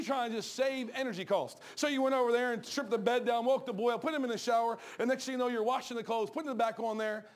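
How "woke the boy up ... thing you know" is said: ordinary talk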